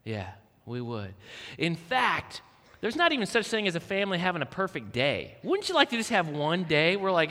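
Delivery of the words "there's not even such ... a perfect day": ordinary delivery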